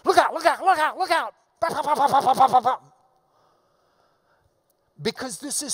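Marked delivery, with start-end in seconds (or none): 0.1-1.3 rapid, high, loud look outs; emphatic staccato warning; machine-gun warning. Shown as a demonstration. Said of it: "another way of showing emphasis". 1.6-2.8 loud rapid staccato ba; machine-gun ba. Retold or imitated. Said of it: "like a machine gun".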